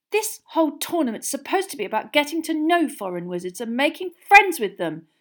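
high, loud shrill argument. A narrator's reading. Girl defending friendship with foreign wizards, shrill. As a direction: argue shrilly, pitched right up. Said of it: "said Hermione shrilly".

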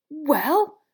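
loud, high, indignant disbelief; thunderstruck splutter. A narrator's reading. Ron turns to Harry after the row, thunderstruck splutter. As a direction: burst out as if you can't believe it. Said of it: "he spluttered, looking thunderstruck".

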